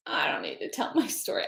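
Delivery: whispered, withdrawing from the group; soft whisper turning down her turn; hushed refusal. Retold or imitated. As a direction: whisper it, trying to dodge attention.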